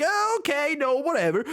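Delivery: high, offhand "whatever" voice; dismissive self-quote. Retold or imitated. Recalling a first reaction to the argument, high and dismissive. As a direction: toss it off, pitched well up. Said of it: "At first I was like".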